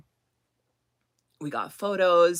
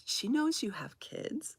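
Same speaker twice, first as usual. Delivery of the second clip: put-on softer, high questioning child's voice; surprised seven-year-old; 7 semitones higher, 9 dB quieter than usual. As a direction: imitate a kid asking in disbelief. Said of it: "This is what my seven-year-old said".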